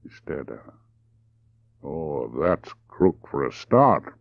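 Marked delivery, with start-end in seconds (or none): 1.8-4.2 slow, deliberate remark; unhurried drawl. Acted. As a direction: let each word stretch out. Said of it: "he said slowly".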